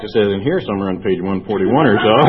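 usual speaking voice

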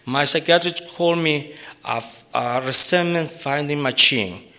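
normal talking voice

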